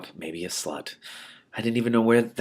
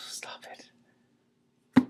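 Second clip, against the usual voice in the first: breathy whispered aside, softer; whispered stop it; 9 dB quieter than usual. Real, not acted. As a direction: hush it to yourself. Reader's aside, overcome.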